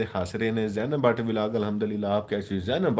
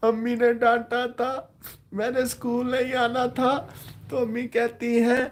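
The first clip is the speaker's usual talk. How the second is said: put-on innocent tearful child; 13 semitones higher than usual. A sulking child answering his teacher, high and tearful. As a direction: whimper it like an innocent little boy. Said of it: "बड़ी मासूमियत से रो के".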